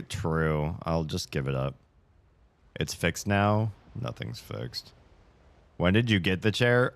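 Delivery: ordinary talk, nothing marked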